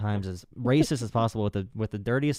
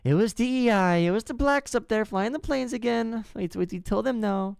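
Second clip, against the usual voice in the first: Trump; 11 semitones higher than usual. Retold or imitated. President blaming a plane crash on D E I, mocking high-pitched rant. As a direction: mimic a blustering politician, pitched way up. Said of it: "Trump literally said the next day".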